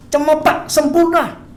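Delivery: ordinary talk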